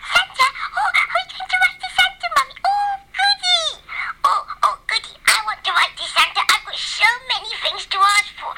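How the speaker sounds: high, squealing dog delight; a dog thrilled at the idea of Santa; excited puppy. Acted. Dog hears about Santa letters, high excited squeal.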